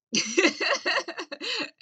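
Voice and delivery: high cackle of a laugh. Real, not acted. Comic laughing at his own punchline, high cackle.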